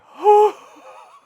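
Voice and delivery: big high sigh after a scare; shaky relieved sigh. Real, not acted. Vlogger calming after a scare, high relieved sigh. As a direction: let out the fright in one high breath. Recovering from a fright.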